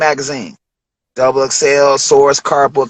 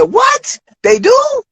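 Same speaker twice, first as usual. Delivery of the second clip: much higher, thrilled surprise; surprised excitement; much slower than usual. Retold or imitated. Rapper hearing about the video shoot, thrilled surprise. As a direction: jump at the good news. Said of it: "I said".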